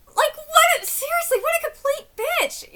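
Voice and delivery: furious at the wife in the story; outraged. Real, not acted. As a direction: spit out the insult in shock.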